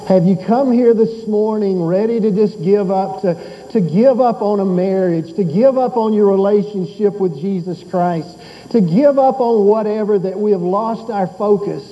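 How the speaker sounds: rising, fervent sermon appeal; preaching. Acted. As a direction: press the congregation, building. Preaching builds up.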